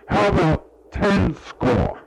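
voicing Jesus. Recalling the altar, he voices Jesus bargaining high and slow. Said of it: "Jesus seemed to say".

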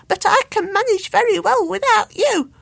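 high, loud bird laughing at him; mock him with a laugh; giggling cheeky bird; laughing taunt. A narrator's reading.

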